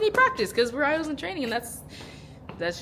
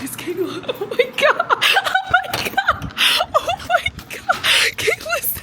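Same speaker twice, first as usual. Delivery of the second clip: much higher, louder, laughing squeals of oh my god; excited squealing; 4 dB louder than usual. Real, not acted. Fan overjoyed to see Kayla arrive, squealing and laughing. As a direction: squeal with giddy delight, barely breathing. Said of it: "I'm hyperventilating".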